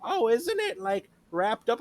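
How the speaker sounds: high, slow, clueless customer voice; mock customer voice. Worker mimicking a clueless customer, high and slow. Customer quoted in retail story.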